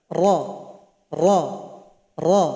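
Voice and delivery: "Ra" with the tongue drawn back, thick; inhiraf demo. Shown as a demonstration. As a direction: pull the tongue back for each "ra". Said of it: "itu namanya inhiraf".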